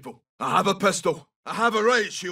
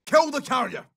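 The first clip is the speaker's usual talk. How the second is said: urgent combat order; 8 semitones higher than usual. Acted. Combat order in game voice lines.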